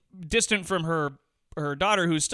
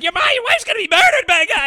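very high, loud hammed-up shriek; shrill mock yell; 9 dB louder, faster than usual. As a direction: shriek the warning like a frantic lady. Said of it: "she's going like".

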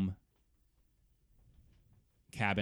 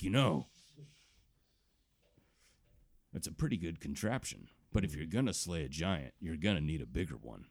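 gentle uncle advice; 4 semitones lower than usual. Acted. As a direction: tell it like a kind uncle. Uncle advises child.

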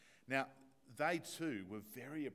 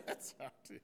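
laughing; 10 semitones higher, 3 dB louder than usual. Real, not acted. Laughing at own story.

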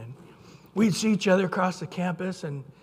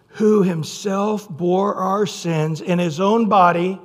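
louder, measured scripture reading; 2 semitones lower, 4 dB louder than usual. Acted. Preacher reading Isaiah to his congregation, proclaiming. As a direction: proclaim the verse to the church.

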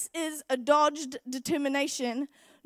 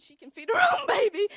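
laughing shout; 6 semitones higher, 8 dB louder than usual. Retold or imitated. Bitter joke after being left.

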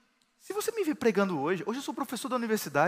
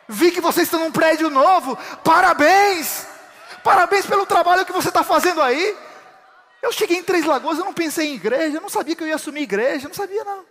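full-force preaching; 10 semitones higher, 10 dB louder than usual. Acted. Pastor preaching a convert's testimony to the congregation, loud and high. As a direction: proclaim the testimony to the church.

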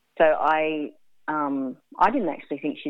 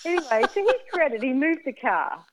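laughing; 9 semitones higher than usual. Real, not acted. Narrates while still laughing.

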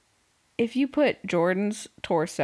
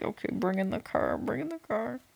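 hushed radio call from a producer; producer on a walkie-talkie; 2 semitones higher, 9 dB quieter than usual. Acted. Producer quietly cueing the crew over walkie-talkie, hushed. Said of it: "imagining the producer on the walkie-talkie".

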